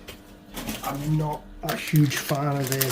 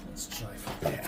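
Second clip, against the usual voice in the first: breathy whispered repeat, much softer; 5 semitones lower, 9 dB quieter than usual. Shown as a demonstration. Toy builder repeating himself to camera, whispered. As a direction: hush it right down, no voice.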